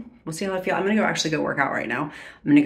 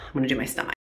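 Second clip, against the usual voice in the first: "I'm gonna do my stomach" said again, softer and under the breath; hushed repeat; 4 semitones lower, 8 dB quieter, much faster than usual. Real, not acted. Vlogger steeling herself before the injection, hushed.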